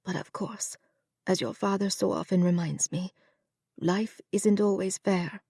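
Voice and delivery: a hint of a weary old mother letting go; resigned mother. To her son about fate, soft and resigned.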